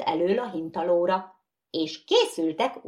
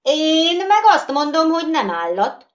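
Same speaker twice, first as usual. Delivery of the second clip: loud, high retort; quarrelling shout; 10 semitones higher, 8 dB louder than usual. Acted. Second skittle contradicting the first, loud retort. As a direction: shout back.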